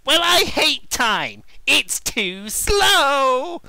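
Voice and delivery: slow, loud grumpy whine. Acted. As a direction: sulk at waiting. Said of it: "all grumpy like".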